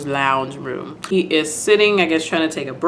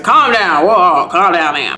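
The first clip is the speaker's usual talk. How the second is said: louder imitation of an alarmed doctor; urgent calming; 5 dB louder, slower than usual. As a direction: cut in loudly to settle her down. Doctor acted, calming hysterical patient.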